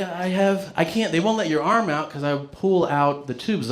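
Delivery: usual speaking voice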